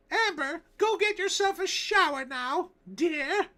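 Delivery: put-on very high, loud falsetto old-timey housewife; 1909 New Jersey housewife. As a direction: shriek it like a frantic 1909 housewife telling of the devil. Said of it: "So this was The Voice I did".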